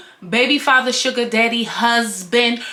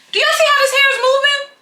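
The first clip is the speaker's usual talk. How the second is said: excited; 13 semitones higher, 4 dB louder, faster than usual. Real, not acted. Excited showing wig.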